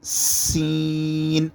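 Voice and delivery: سین with a sharp s, long ee held level; strong hissed سین. Shown as a demonstration. Teacher demonstrating the letter, سین sharp and drawn out. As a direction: hiss firmly into a stretched سین. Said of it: "سین کی آواز زور سے آئے گی".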